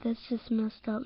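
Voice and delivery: ordinary talk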